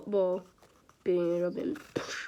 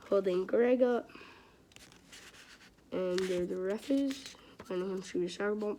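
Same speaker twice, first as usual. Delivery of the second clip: normal talking voice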